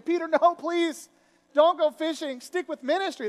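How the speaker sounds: high, loud mock begging; mock pleading. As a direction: beg him urgently not to go.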